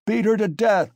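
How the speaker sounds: put-on loud, high drunken yell; furious cry. A narrator's reading. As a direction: scream it in rage. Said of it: "cried Mikolka".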